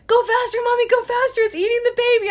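high, loud imitation of a frantic little girl; daughter urging. Mother imitating her daughter begging her to hurry, high and frantic. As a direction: squeal it like a panicked kid. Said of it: "My daughter's like".

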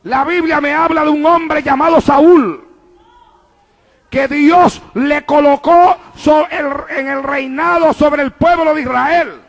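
rising, emphatic pulpit delivery; preaching at full force. Real, not acted. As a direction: proclaim it to the whole church. Predica a plena fuerza. (predicando con fervor a plena voz)

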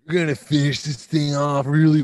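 high goofy stoner voice. Pretend. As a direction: ham up a spaced-out stoner. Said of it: "like a stoner".

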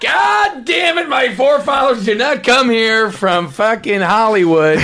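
hammed-up ranting old-timer, pitch climbing; mock angry bigot. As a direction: rant like an outraged xenophobe. Mock bigoted rant about Mexicans.

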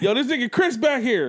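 loud, high, laughing callout; excited kid on the bus. Retold or imitated. Bus kid calls out Chris to everyone, laughing loud. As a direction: point him out to the whole bus, laughing. Bus kids pointing him out.

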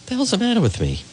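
high, gruff self-rebuke; mock gangster self-scolding. Host scolding himself over lost notes. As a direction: ask himself like a wiseguy. Frustrated at losing name.

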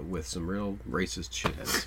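low, slow, soft delivery; too quiet. Real, not acted. Co-host casually describing the era to the other host, too quiet. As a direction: quietly trail along, barely projecting. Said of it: "You're so quiet".

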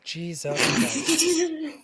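drawn-out high exasperated groan. Real, not acted. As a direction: stretch it out in disbelief. Breaks off reading in disbelief.

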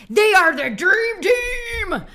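drawn-out, high American cheer; American accent. Shown as a demonstration. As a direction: shout it like an American hype announcer, stretching the words. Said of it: "I'm gonna do it in my American accent".

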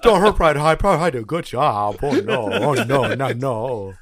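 goofy put-on voice, flustered; dumb private rambling. Recruit pleading with drill sergeant, goofy voice. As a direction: babble like a confused recruit.

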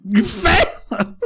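high, slow laughing surprise; laughing disbelief. Real, not acted. Friend hears co-host failed history, laughing surprise. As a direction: stretch it out in amused disbelief. Friend reacts to failing grade.

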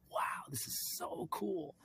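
high, soft, drawn-out wow; retold awe. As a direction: marvel quietly in a high, slow voice.

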